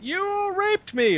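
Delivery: very high, slow falsetto accusation; drawn-out squeaky woman's voice; mock woman's voice. Host voicing the concubine's complaint, high mocking falsetto. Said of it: "she's like".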